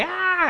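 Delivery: high mock yell of a wild man; sudden high cry, acted not screamed; retold yell.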